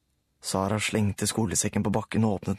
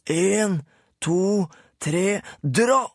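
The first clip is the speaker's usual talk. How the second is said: Rulle counting; 9 semitones higher, 4 dB louder, much slower than usual. A narrator's reading. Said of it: "sa Rulle".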